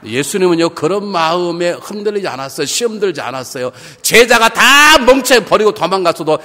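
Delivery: loud, high, impassioned sermon; full-force preaching. Real, not acted. Preacher contrasts Jesus on the cross with us, full-force preaching. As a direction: drive it home to the whole congregation.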